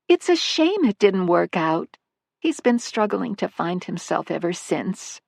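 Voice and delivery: high, light motherly voice; a fond older mother chatting about her son; elderly mother. Acted. Missus Manley defends her son.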